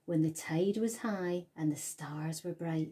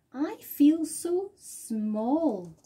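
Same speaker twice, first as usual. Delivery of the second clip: slow, higher awed snail voice; awed small voice; 7 semitones higher, 4 dB louder, much slower than usual. A narrator's reading. Snail telling the whale she feels tiny, awed. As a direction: marvel at the world. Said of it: "and she said to the whale".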